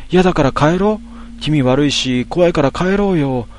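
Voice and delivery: high, frightened plea to go; a spooked friend begging to go elsewhere; scared pleading. Acted. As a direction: whine to leave, near tears.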